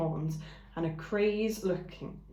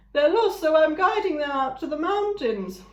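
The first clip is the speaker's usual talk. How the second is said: ram announcing; 10 semitones higher, 7 dB louder than usual. A narrator's reading. Ram announcing his new friends to the flock, proud. Ram's voice.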